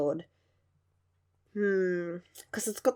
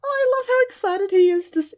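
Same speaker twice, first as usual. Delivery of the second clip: ordinary delivery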